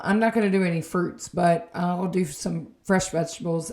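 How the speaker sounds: ordinary talk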